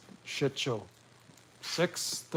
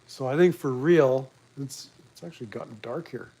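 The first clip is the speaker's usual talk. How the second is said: ordinary talk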